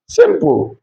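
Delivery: usual speaking voice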